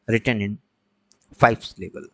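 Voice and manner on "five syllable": normal talking voice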